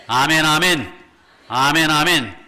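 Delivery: shouted amen, amen; loud amen. Real, not acted. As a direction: call out amen with fervor. Congregation-style amen calls. (크게 외치는 아멘)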